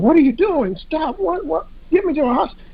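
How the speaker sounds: high, frantic pleading from a wife just shot; panicked wife. Retold or imitated. Wife begging her husband to stop, high panicked pleas. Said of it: "she's standing there and going".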